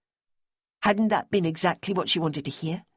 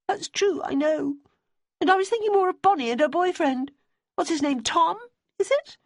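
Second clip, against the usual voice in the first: higher, pleading woman's voice; Debbie's voice; 14 semitones higher than usual. A narrator's reading. Debbie asking Simmy about Bonnie's boyfriend, high and earnest. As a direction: voice a worried, earnest widow.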